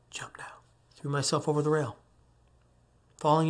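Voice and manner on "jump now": hushed inner voice, whispering; whispered command. Retold or imitated. Hallucinatory voice ordering him to jump, whispered. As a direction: whisper it like a voice inside the head.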